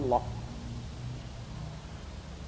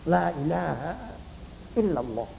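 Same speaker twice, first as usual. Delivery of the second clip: soft prompting; 2 semitones lower, 8 dB quieter than usual. Shown as a demonstration. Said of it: "laqinu mautakum".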